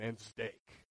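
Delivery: low mock mumble; mock grumbler. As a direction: grumble it under your breath. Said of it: "a guy there who's saying".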